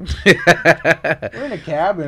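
slow, loud words through a laugh. Real, not acted. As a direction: say it laughing, drawn out.

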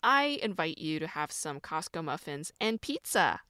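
ordinary delivery

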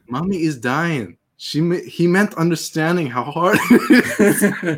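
high, odd Tommy Wiseau voice; Tommy Wiseau impression. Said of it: "You do a really good Tommy Wiseau".

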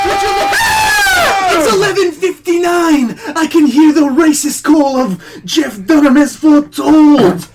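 high, loud excited shouting. Acted. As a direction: shout the big news. Midnight arrives, salvation.

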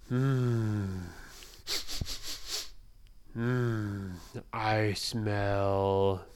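low, slow giant voice; sniffing giant. Acted.

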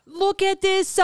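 high, stretched mock Illinois accent; teasing Illinois drawl, vowels dragged out; exaggerated Illinois drawl. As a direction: gush in a hammed-up Illinois voice. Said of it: "why do I talk like that".

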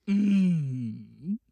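loud, drawn-out theatrical hum; hammed-up stagey host; affected staff voice. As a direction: hum it long and grandly. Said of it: "they speak like this".

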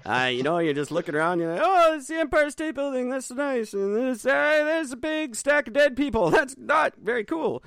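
high, fast gawking tourist voice; tourist impression. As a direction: play a dopey sightseeing tourist. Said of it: "That's my impression of a tourist in New York".

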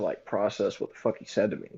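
normal talking voice

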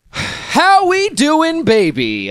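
slow, high and loud stretched greeting; catchphrase. Pretend. Host drawls his podcast catchphrase to the listeners, slow and high. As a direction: savour every word of the catchphrase. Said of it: "I just love saying that".

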